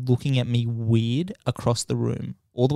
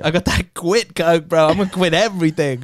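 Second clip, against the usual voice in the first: higher, giddy swagger; hyped-up bravado; 6 semitones higher, 3 dB louder than usual. Acted. Man high on quitting, joking hyped-up bravado. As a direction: boast it, buzzing from the win.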